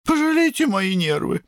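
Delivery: very high-pitched plaintive voice; wailing mother. A narrator's reading. Mother begging her sons to spare her, wailing. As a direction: lament in a high, pleading whine. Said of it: "запричитала госпожа Рупамера".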